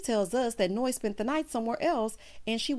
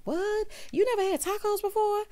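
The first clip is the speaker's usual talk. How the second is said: mock shock; 12 semitones higher than usual. Voicing everyone's shock.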